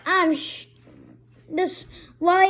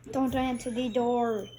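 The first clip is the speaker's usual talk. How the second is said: ordinary delivery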